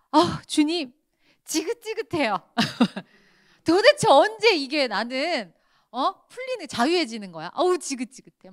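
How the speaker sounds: 높고 큰 소리로 투덜대는 불평; 지긋지긋하다는 듯 짜증 섞어 하소연하기; 원망하는 기도. Retold or imitated. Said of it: "원망불평 기도하는 거지". (돈 문제로 하나님께 불평하는 기도, 짜증스럽게)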